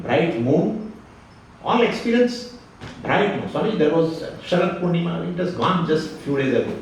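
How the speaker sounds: normal talking voice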